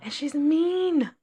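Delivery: slow disgusted "mean"; emphatic complaint. Real, not acted. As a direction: stretch out "mean" slowly with disgust. Venting about rude coworker.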